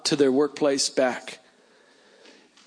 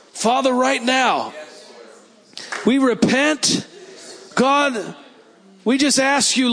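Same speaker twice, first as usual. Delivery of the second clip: higher, slow, declaring prayer; fervent prayer; 7 semitones higher, slower than usual. Acted. Preacher praying over the congregation, higher fervent declaring prayer. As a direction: cry out to heaven for rain, stretching the words. Prays repentance over the room.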